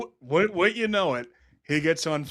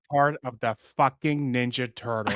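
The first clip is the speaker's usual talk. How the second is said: low voice, lightly put on; Tony impression; 6 semitones lower than usual. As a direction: imitate an awestruck Tony, pitched down. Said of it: "I gotta save that voice because that's the exact same voice I will do for Andy Dick".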